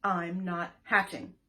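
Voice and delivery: loud stubborn egg; loud stubborn refusal. Acted. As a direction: plant your feet and refuse.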